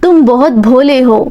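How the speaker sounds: ordinary delivery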